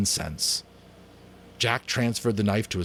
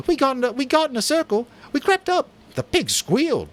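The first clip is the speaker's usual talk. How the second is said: high, fast, loud overlapping boasts; excited hunters; 6 dB louder than usual. Acted. Hunters cut in to brag about the kill, excited. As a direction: tumble over each other to tell it. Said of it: "Voices broke in from the hunters".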